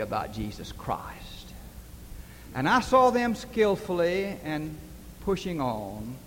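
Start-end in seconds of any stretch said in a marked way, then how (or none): 0.0-1.5 hushed whisper. Retold or imitated. Girls' earnest witness quoted.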